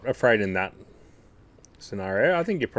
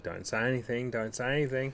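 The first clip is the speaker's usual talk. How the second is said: much softer, muttered to oneself; quiet self-talk; 3 semitones higher, 10 dB quieter than usual. Retold or imitated. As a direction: talk yourself into silence under your breath. Self-talk holding back.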